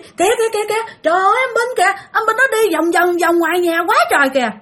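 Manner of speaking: very high, urgent repeated cries; retold alarmed calling. Possessed mother keeps warning of spirits circling the house, alarmed calling. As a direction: call out the sighting high and excited. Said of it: "Mà mẹ cứ kêu".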